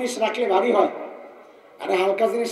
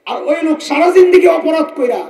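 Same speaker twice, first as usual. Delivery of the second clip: emphatic shout; 6 semitones higher, 10 dB louder than usual. Real, not acted. Preacher's emphatic outburst.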